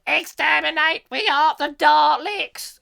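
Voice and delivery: high, loud Dalek screech. Retold or imitated. Host doing Daleks with a cold, Dalek screech. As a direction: grate it out like a Dalek. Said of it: "I can't do the Daleks properly".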